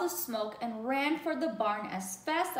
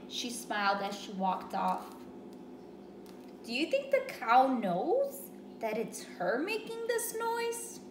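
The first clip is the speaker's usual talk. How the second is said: ordinary delivery, nothing marked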